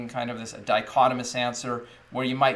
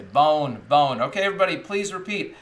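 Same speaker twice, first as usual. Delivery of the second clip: teacher model voice; 3 semitones higher, 3 dB louder than usual. Shown as a demonstration. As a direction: announce it big so the kids copy. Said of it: "I'll say".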